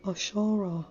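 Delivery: gently calling her name; soft call. A narrator's reading. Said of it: "he called softly".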